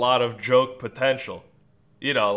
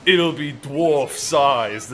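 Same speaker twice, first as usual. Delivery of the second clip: drawn out slowly, louder; slow deadpan threat; 4 dB louder, much slower than usual. Retold or imitated. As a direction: lay it out like a flat threat. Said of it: "he'll be go".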